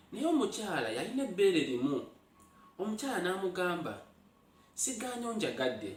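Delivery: animated Luganda tale told with rising energy; spirited narration of an old fable; lively folk storytelling. A narrator's reading. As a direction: tell it like a village storyteller to eager listeners.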